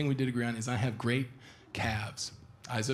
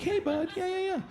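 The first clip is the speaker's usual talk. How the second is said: quiet dog voice. Shown as a demonstration. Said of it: "mine is, like, really, really quiet".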